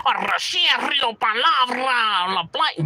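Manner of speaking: high, drawn-out mock rapper bluster; hammed-up slow rolled-R mimicry; exaggerated Chicano rapper. Mimicking the rappers homies would hear, overblown. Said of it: "Why he talking like that?".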